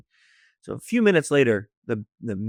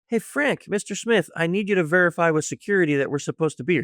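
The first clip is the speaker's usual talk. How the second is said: high-pitched opening, easing down as the request goes on; put-on phone greeting; 6 semitones higher than usual. Calling the accomplice in the van before security, put-on high greeting. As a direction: hail him by name, pitched up, then settle into business.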